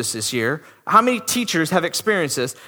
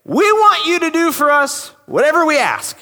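higher, pushy entitled demand; gutsy demand; 7 semitones higher, 4 dB louder than usual. Retold or imitated. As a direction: say it like you own the place. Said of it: "They have the guts to come up to Jesus and say".

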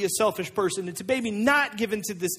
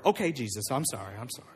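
low, backing-down apology, fading softer; sheepish apology; 8 semitones lower, 6 dB quieter, faster than usual. Acted. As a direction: mumble sorry like someone just told off. Disciple scolded by Jesus apologizes.

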